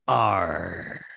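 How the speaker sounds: low pirate growl; a low, growly pirate arr; pirate snarl from below. Acted. Pirate growl heard in market.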